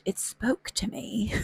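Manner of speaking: slow amused whisper. Real, not acted. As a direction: breathe it out like a confession. Amused aside about relatable quote.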